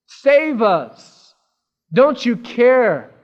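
loud, high, drawn-out plea; freaking out. Retold or imitated. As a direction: cry out for rescue in acted panic. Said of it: "they came to him freaking out".

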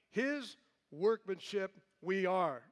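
"His" punched loud at the start, slow; stress on His. Shown as a demonstration. Stressing "His" in the Greek word order for emphasis. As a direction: lead with a heavy "His workmanship". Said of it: "for emphasis, it's emphatic".

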